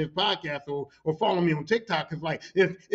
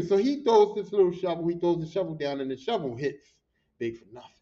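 ordinary talk, nothing marked

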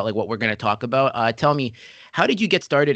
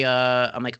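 ordinary delivery

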